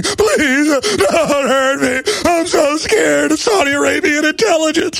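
high, wailing mock whimper; mock begging. Host mocks cowards begging officials, high and wailing. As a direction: ham up a cowering coward pleading.

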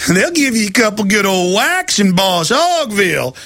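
ordinary delivery

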